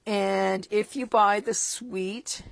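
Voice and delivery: ordinary delivery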